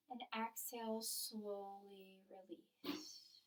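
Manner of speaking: slow, soft breath-cueing voice; guided meditation. Real, not acted.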